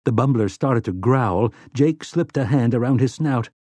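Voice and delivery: ordinary delivery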